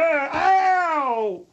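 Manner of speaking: high, drawn-out "ow"; pained yelp. Acted. Man pinched for laughing, pained yelp. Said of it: "That hurt".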